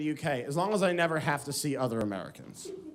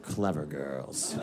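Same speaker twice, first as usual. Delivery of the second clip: low, slow, wary murmur; Jurassic Park hunter; 13 semitones lower, 5 dB quieter, much slower than usual. Retold or imitated. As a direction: say it slowly and deep, like cornered prey admiring the hunter. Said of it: "it's very Jurassic Park".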